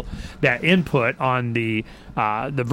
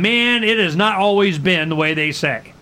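ordinary delivery

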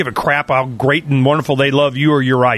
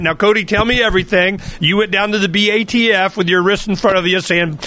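ordinary talk, nothing marked

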